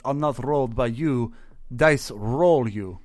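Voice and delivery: put-on slow, deliberate Russian accent. Player trying out the bear character's voice, Russian accent. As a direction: draw it out in a mock Russian accent. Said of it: "try to do a Russian accent".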